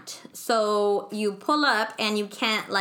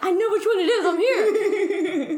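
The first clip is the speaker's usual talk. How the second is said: laughing insistence; 8 semitones higher, 4 dB louder than usual. Retold or imitated. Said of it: "And I'm like".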